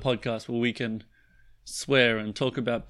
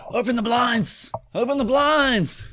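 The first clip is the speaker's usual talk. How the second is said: high, loud urgent shout; excited shout; 11 semitones higher, 5 dB louder than usual. Retold or imitated. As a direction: shout it like a morning wake-up call. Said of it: "I'm like".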